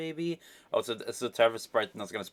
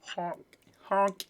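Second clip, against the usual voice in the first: clown honk; 9 semitones higher, 5 dB louder than usual. Acted. Clown honking at troll.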